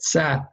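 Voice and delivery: sa said with spread lips; smiling sa. Shown as a demonstration. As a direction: smile while saying sa. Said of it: "You have to smile when you say it".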